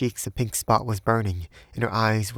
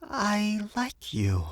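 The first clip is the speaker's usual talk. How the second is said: very slow, high woman's voice; slowly, deliberately confessing in a high voice; slow confession; 11 semitones higher, 3 dB quieter, much slower than usual. A narrator's reading. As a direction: draw out each word, high and deliberate. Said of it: "she said slowly".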